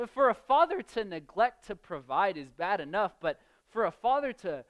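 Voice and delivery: usual speaking voice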